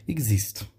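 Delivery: "Existe" said as eg-zist; French X sound. Shown as a demonstration. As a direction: voice the X of "Existe" as G plus Z. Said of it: "Um G com Z".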